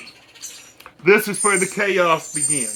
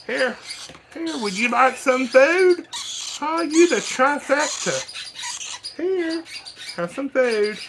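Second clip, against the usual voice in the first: high, coaxing pet voice; 7 semitones higher, slower than usual. Real, not acted. Offering food to baby birds.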